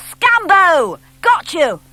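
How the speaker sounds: loud, high triumphant yell; crowing loud yell of a boy who caught his friend; yell from the wood. A narrator's reading. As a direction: shout out that you caught him. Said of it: "a crash and a yell of".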